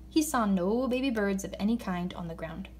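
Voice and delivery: plain reading voice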